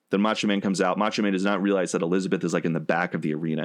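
ordinary talk

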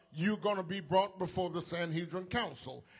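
usual speaking voice

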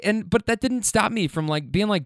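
normal talking voice

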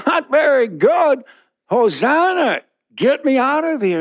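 high, loud pleading cry; desperate plea. Acted. Man in the pit answering Buddha, high desperate plea. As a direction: beg to get out. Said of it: "And you go".

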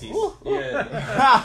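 high excited squeal; excited ooh. Real, not acted. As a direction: squeal with delight. Reacting to Versace mention.

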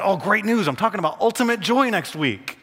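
high, loud burst of good news; eager retold announcement; excited announcement. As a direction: announce it brightly, pitch and volume up. Cheerfully announcing to a struggling friend.

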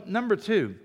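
normal talking voice

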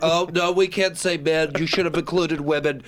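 lightly voiced whiny nerd; a hint of a fussy nerd scolding. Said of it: "It's my generic nerd voice".

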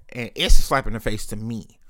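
normal talking voice

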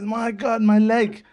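high, loud mock amazement; amazed exclamation. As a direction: cry out as if miraculously healed. Healed man exclaims in a joke.

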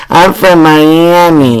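drawn-out tipsy brag, slow; slurring it like a tipsy friend. Retold or imitated. Co-host imitating a tipsy friend's boast, slow and lit. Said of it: "she starts saying".

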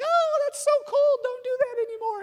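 very high, squealing mock protest; imitated squeal. Daughter doused with cold water in the shower, playful squeal. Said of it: "usually you hear the squeal".

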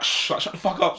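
mock shushing. Cinema audience to noisy woman, imitated irritated shushing. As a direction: imitate an audience telling someone to shut up. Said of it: "So people were like".

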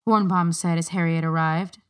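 usual speaking voice

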